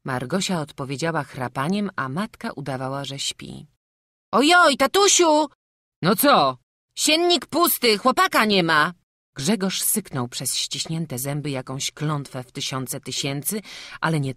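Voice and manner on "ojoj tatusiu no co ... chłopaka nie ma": high loud alarm; alarmed cry. A narrator's reading. Frącek crying to his father that the boy is gone, high and loud. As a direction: cry out in shock.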